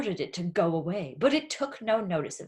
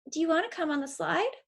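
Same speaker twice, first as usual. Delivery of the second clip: higher, quick lightly voiced girl; a girl's invitation; 9 semitones higher, much faster than usual. A girl inviting the boy on the slide, quick and higher. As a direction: ask brightly, quickly. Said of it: "a voice asked".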